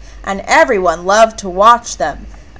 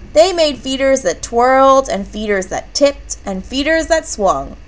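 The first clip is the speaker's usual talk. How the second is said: ordinary delivery, nothing marked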